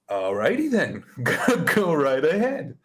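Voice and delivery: incredulous laughing send-off; amused dismissal. Retold or imitated. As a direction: laugh it off as you wave them on. Laughs off threat to cancel account.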